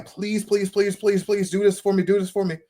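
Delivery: string the pleas together like someone begging; imitated insistent beggar's prayer; mock begging. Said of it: "instead of begging and saying".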